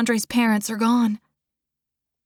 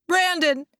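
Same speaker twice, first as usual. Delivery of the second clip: high, distressed cry of a name; anxious, high-pitched shout; alarmed call; 8 semitones higher, 3 dB louder than usual. A narrator's reading. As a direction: call out to rouse him. Calling dying brother, shaking him.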